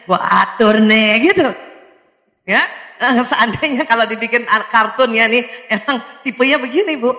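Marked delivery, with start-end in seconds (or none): 0.1-1.3 slow, smug little boss; a bossy baby thinking it over, slowly; baby's inner voice. Pretend. A baby thinking inside about ruling the parents, slow and smug. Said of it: "Dalam hatinya".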